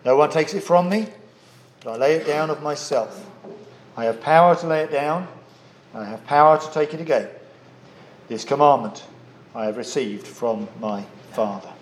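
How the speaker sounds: measured, solemn reading of scripture. Acted. Preacher reading the gospel passage to the congregation, solemn and measured. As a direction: read the verses aloud to the congregation. Reads scripture before sermon.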